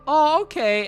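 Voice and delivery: meek squeak. Retold or imitated. Kids told no, comply.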